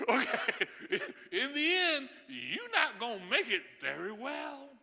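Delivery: normal talking voice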